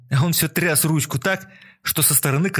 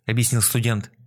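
usual speaking voice

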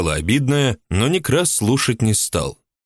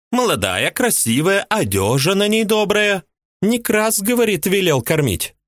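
a much higher woman's voice, resentful and indignant; jealous housewife; 12 semitones higher than usual. A narrator's reading. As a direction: play the jealous mistress complaining. Woman character voiced high.

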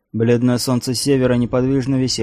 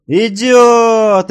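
high, very loud drawn-out cry; piercing lookout's shout; frantic yell; 12 semitones higher, 12 dB louder than usual. A narrator's reading. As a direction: scream the warning from the lookout. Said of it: "истошно завопил младший Олафсон".

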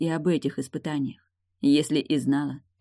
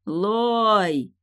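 higher, long arching call; shouting a name; 6 semitones higher, 3 dB louder than usual. A narrator's reading. Husband in ward shouting for his wife. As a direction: stretch the name into a moderate shout. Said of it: "кричал Райлих".

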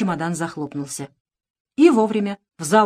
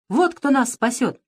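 exclaiming; 6 semitones higher, slower than usual. A narrator's reading. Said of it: "воскликнула королева".

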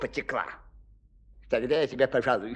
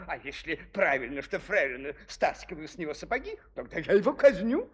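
fast, tangled royal logic; rushed reasoning; faster than usual. Acted. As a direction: hurry through the confused logic. King tangled reasoning, rushing. (торопливые рассуждения короля)

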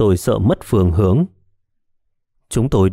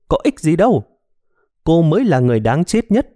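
higher, scornful ghostly woman; spiteful woman's voice; 7 semitones higher than usual. A narrator's reading. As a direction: sneer at her to break her. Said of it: "giọng nói chanh chua". (giọng phụ nữ chanh chua, khinh miệt)

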